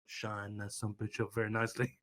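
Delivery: low, menacing wrestler build-up; promo mode. Acted. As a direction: start the threat low and measured. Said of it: "I just go promo mode".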